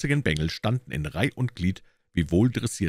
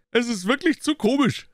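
much higher and louder, amused; gleeful; 4 dB louder than usual. A narrator's reading. Gregson gloating about Lestrade's blunder. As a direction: crow with delight. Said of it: "brach in ein schallendes Gelächter aus".

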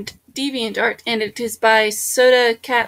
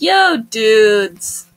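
loud, put-on dude voice; laid-back dude; 6 dB louder than usual. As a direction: greet them like a slangy, unpreppy girl. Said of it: "she's probably like".

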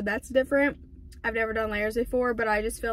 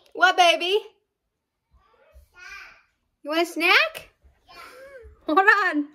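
raised, higher voice to a little one; 9 semitones higher, 7 dB louder than usual. Real, not acted. Mom answering her child mid-vlog, calling out. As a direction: call out loud and high.

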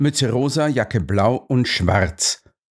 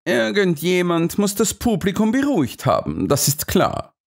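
raised, animated character voice; voiced story dialogue; 8 semitones higher than usual. A narrator's reading. Someone reasons about the crowd, voiced higher. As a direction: speak the quote higher than the narration. Holmes or companion speaking in story.

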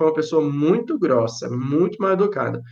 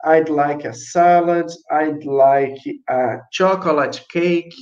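slow model orders; much slower than usual. Shown as a demonstration. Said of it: "Então".

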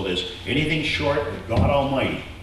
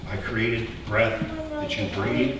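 softer, tender and sincere; tender God voice; 3 semitones lower, 8 dB quieter than usual. Acted. God tells Israel softly why he chose them. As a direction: say it as a loving promise. God's loving words to Israel.

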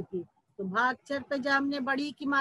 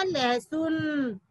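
लहसुन stretched out slowly; slow modelling; much slower than usual. Shown as a demonstration. Teacher answering what ला stands for, drawn-out लहसुन. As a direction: draw out both syllables of लहसुन.

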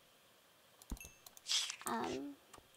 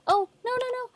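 panicked; 12 semitones higher, 5 dB louder, faster than usual. Real, not acted. Panicked no's.

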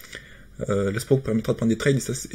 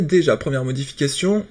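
ordinary delivery

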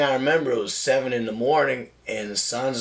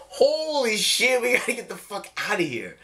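mock alarm; 9 semitones higher than usual. Lawyers spot them at dawn.

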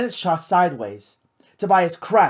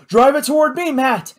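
high, loud urgent shout; 8 semitones higher, 5 dB louder than usual. A narrator's reading. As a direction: Tobias shouts to Matt across the lounge, loud. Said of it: "shouted Tobias".

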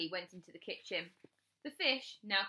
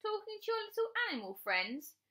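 very high, mocking question; teasing; 13 semitones higher than usual. A narrator's reading. Sister mocking Bradley's animal friends, teasing. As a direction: taunt him in a babyish high voice. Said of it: "she teased".

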